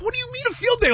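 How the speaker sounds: high-pitched mock-offended question; indignant disbelief. Host retells his comeback to the sassy host, incredulous squeal. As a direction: retell a protest, voice leaping way up.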